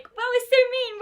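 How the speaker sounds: high, loud mock complaint; mock protest. Tutor voicing her student's imagined protest, high and mock-hurt. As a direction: play the wounded student, squeaky and loud. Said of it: "I know you're like".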